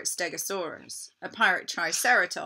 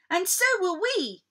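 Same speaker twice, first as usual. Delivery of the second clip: put-on high eager kids; children's voices; 13 semitones higher, 6 dB louder than usual. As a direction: chime in brightly. Friends join in.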